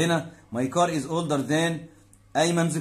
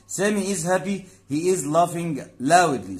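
slow teaching read; 3 semitones higher, 4 dB louder, much slower than usual. Shown as a demonstration. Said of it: "teacher reads English example".

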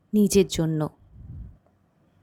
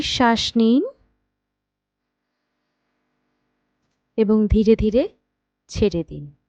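guided meditation. Acted. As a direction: guide them unhurriedly into rest, phrase by phrase. Leads a guided night meditation. (ধীর লয়ে, থেমে থেমে ধ্যান করানোর কণ্ঠ)

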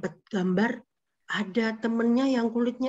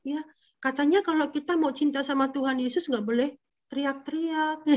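ordinary talk

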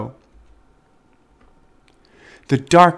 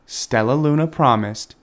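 plain reading voice

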